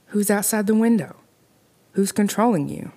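put-on a scared girl demanding who's there; frightened questions. A narrator's reading. Girl in bed calls out to the dummy, scared and urgent.

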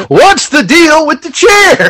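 high, drawn-out comic yell; hammed-up bewildered shout. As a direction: yell the question in mock disbelief. Mimicking wrestler puzzled by the chair.

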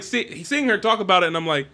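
normal talking voice

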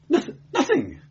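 high, stammering terrified clerk; teeth-chattering fear. A narrator's reading. Clerk questioned by the inquisitor, stammering with fear. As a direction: stutter it out in a high, frightened voice. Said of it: "clerk's teeth chattered as he spoke".

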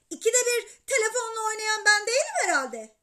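high, loud wifely comeback; indignant retort. A narrator's reading. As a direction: throw the blame back sharply. Anne kavgada babaya laf sokuyor. (tiz sesle çıkışarak)

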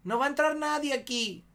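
high mock voice of a stubborn woman; mujer atrincherada. Mujer atrincherada niega la entrada. (imitando a mujer que se niega)

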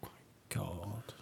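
muttered, low "oh my God"; groaning dismay. Retold or imitated. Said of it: "the other half are going".

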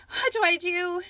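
high, loud, panicked cry; desperate shout. A narrator's reading. Alexandria alone against the army, shouting in panic. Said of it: "she shouted".

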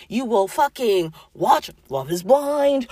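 usual speaking voice

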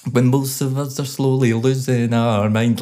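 put-on sing-speak the lyric in a light Scottish accent, punchy and plosive; mock Scottish accent. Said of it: "his Scottish accent is very kind of rhythmical".

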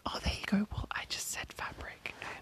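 ordinary talk, nothing marked

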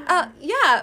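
ordinary delivery

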